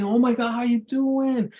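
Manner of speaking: high, oily mock gush; creepy guy greeting. Mocking creepy men going for a hug, high sleazy voice. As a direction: ham up a sleazy hello. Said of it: "and they're all creepy".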